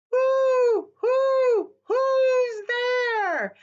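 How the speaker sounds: high, loud owl hoot. Acted. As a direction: call it out like a startled owl. Owl answers the boy.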